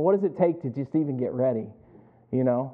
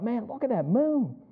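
higher, softened marveling thought; quiet wonder; 6 semitones higher, 4 dB quieter than usual. Retold or imitated. His own awed thought retold.

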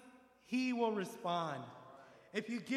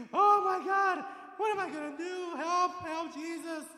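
higher, louder mock panic crying for help; panicked yelling; 8 semitones higher, 6 dB louder than usual. A person panicking in the storm yells for Jesus, mock panicked cry. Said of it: "while you are yelling and screaming".

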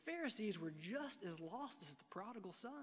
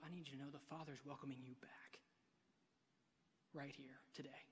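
whisper; 5 semitones lower, 10 dB quieter than usual. Real, not acted. Pastor's tender personal appeal.

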